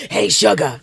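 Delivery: lightly voiced smoker's hello; a hint of a smoky diner regular; mock raspy smoker voice. Host mocks her own smoker sound, light raspy greeting. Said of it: "how I feel like I sound".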